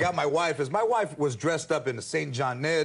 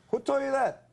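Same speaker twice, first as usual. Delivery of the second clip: higher-pitched mock defensive question; high, evasive counter-question; busted comeback; 7 semitones higher than usual. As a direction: imitate someone caught out, pitching it up. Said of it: "when Chicanos know we're busted we always go".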